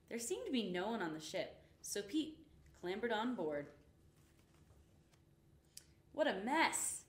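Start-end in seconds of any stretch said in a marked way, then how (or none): 6.1-7.1 gasped. A narrator's reading. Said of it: "gasped Pete".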